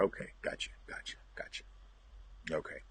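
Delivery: unvoiced whisper, gotcha to himself; a quick whispered aside to himself. Real, not acted. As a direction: breathe the gotchas almost silently. Muttered acknowledgment off mic.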